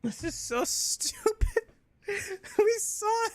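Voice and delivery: very high, slow laughing complaint. Real, not acted. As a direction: crack up in disbelief. Laughing exasperation at plot.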